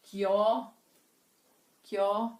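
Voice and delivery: "Que ó" fused into a single kió; "Que ó" run together and held, then repeated; two vowels as one syllable. Shown as a demonstration. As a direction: glide "Que" straight into "ó" as one beat. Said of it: "eu vou contar uma sílaba só".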